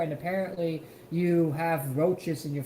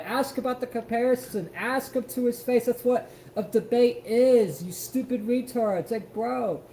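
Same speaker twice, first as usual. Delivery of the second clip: usual speaking voice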